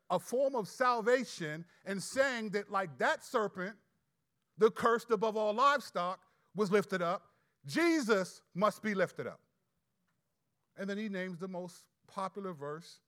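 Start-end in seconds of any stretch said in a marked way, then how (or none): 0.1-9.4 louder and louder to a ringing point; preaching build. Real, not acted. Preacher pressing the church on salvation, rising loud. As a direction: build it up like a preacher hammering it home.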